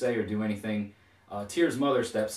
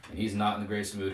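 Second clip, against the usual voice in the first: usual speaking voice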